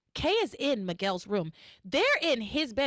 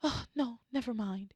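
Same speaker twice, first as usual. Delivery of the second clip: soft, hesitant mock timidity; lightly acted timid voice; timid request; 6 dB quieter than usual. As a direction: start to ask shyly, then back off quietly. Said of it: "she asks him very timidly".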